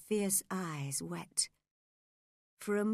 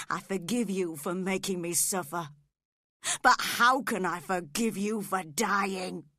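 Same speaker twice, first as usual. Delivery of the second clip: anguished outburst; 7 dB louder than usual. Acted. Heathcliff agonised at her dying.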